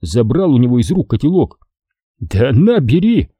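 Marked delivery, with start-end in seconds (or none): 2.2-3.3 displeased. A narrator's reading. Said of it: "недовольным голосом произнёс Качан".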